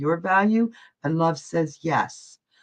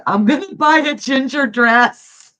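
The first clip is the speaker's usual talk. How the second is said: louder, higher, laughing through the words; laughing while reading; 6 semitones higher, 8 dB louder than usual. Real, not acted. Host laughing as she reads a caller's comment. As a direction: giggle out the caller's silly line.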